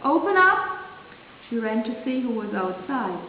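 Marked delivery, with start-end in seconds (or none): 0.0-0.8 high call through the door; frog crying out. A narrator's reading. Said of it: "knocked at the door and cried out".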